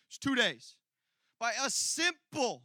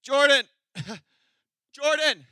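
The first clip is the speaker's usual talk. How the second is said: loud laughing call for Jordan; loud laughing call across the room; calling for help; 6 semitones higher, 11 dB louder than usual. Retold or imitated. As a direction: yell a friend's name, laughing. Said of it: "I remember being like".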